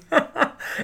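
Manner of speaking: unvoiced, airy laugh; breathy laugh. Real, not acted. Recalling the echoing phone.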